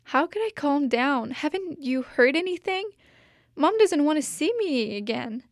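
lightly voiced distressed child; high, tearful protest; girl despairing to her cat after being scolded; upset little girl.

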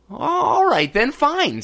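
high, loud, slow huff of giving in; impatient. Acted. Princess grudgingly agrees to the frog's bargain, huffing. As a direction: grudgingly give in.

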